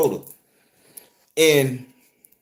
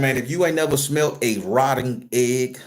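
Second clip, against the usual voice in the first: normal talking voice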